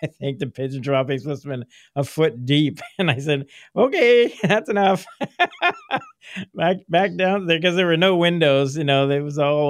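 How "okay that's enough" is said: higher, louder "had enough" exclamation; mock alarm breaking into a laugh; exclaimed retreat. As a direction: call it off in a high voice, half laughing. Acts out retreat from droppings.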